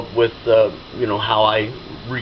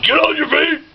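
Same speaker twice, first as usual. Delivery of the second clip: high barked Marine command; loud gung-ho soldier; 3 dB louder than usual. Retold or imitated. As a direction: bark it like a drill sergeant. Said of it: "in my Marine Corps voice".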